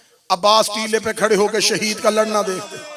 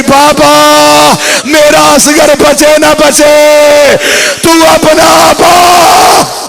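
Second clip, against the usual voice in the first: very slow, high, shouted wail; sobbing plea; 6 semitones higher, 12 dB louder, much slower than usual. Retold or imitated. Sakina begging her father to recall Abbas, shouted wailing. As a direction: cry it out, begging him back. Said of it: "रो के सकीना कहती".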